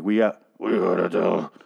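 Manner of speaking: slow, growled Daryl imitation; a hint of a growling Daryl; mock Daryl growl. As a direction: grind it out like a garbage disposal. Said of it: "Daryl is officially just growling".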